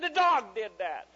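high, loud, slow excuse; imitating a kid blaming someone else; fibbing child. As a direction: blurt the lie loudly and stretch it. Child blames dog.

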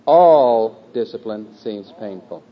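slow, with All loud and drawn out; stress on All. Shown as a demonstration. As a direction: hit All hard, then let the rest fall away. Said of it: "word one".

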